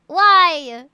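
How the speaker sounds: loud, drawn-out why; exasperated disbelief. Real, not acted. Streamer asking a viewer about their bio, loud disbelief. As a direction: belt out the question in disbelief.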